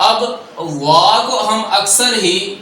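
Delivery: ordinary talk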